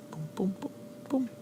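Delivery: very quiet little "boom" sounds; tiny booms, placed one by one; soft boom noises. Shown as a demonstration. Imitated noise while marking.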